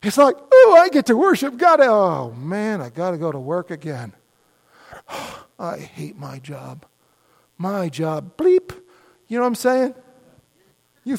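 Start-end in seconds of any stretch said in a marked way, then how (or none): 0.5-8.7 a tired worker's gloomy grumble; grumbling worker. Retold or imitated. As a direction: sigh it out like dreading Monday. Voicing a worker who hates the job.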